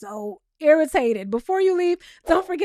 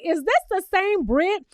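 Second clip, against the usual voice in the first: mock disbelief; 6 semitones higher, slower than usual. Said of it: "I'm like".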